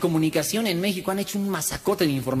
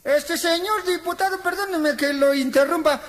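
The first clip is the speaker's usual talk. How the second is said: timid citizen; 10 semitones higher than usual. Retold or imitated. Citizen questions the deputy on campaign, high timid politeness. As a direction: ask the deputy meekly and politely. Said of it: "el diputado en campaña y le dice".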